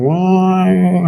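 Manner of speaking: long rising siren wail; vocal mimicry of a siren; imitated siren. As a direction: draw it out like an ambulance siren. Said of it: "Saya bunyiin sirene langsung".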